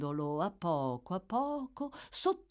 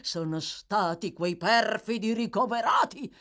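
high-pitched, horrified matron, slowing down; shuddering; 6 semitones higher, 4 dB louder than usual. A narrator's reading. Lady blaming the paupers to Bumble, shuddering. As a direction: shudder at the very thought, voice climbing. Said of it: "disse la dama rabbrividendo".

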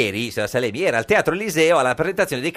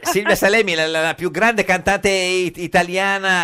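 tono da presentatore enfatico; 7 semitones higher, slower than usual. Acted. Enfatica presentazione della cantante.

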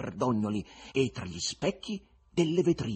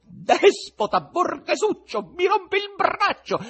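much higher, louder furious shriek; female shriek; 10 dB louder, slower than usual. Acted. Wife fighting her husband in the crowd, furious shriek. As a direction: scream insults back at him. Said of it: "e poi una femminile".

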